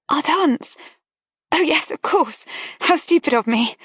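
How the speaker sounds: put-on a startled girl covering her distress; lightly voiced flustered young woman; Miss Devenish, flustered. A narrator's reading.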